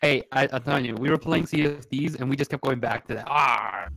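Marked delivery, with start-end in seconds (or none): 3.3-3.9 pirate voice. Retold or imitated. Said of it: "you just wanna talk like a pirate".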